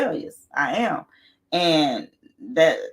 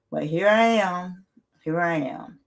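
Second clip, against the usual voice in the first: normal talking voice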